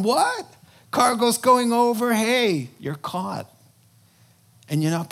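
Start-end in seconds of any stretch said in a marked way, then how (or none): 0.0-2.1 high, loud panicked cry as the storm hits; panicked shout. Pretend. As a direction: shout in sudden alarm.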